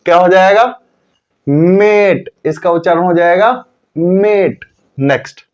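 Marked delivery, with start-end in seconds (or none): 1.5-2.3 मेट said with a long, held ए; long drawn-out vowel. Shown as a demonstration. Teacher answering his own question, मेट drawn out long. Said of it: "लंबा खींचेंगे". 4.0-4.6 मेट with a lengthened ए; long drawn-out vowel. Shown as a demonstration. Teacher repeating the answer for learners, मेट stretched long. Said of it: "लंबा खींचेंगे".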